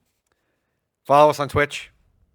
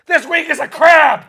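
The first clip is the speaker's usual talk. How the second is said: high, slow, very loud yell; drawn-out high-pitched mock yell; hammed-up announcement; 11 dB louder, slower than usual. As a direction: shout it out like an absurd reveal. Acts out misread giveaway note.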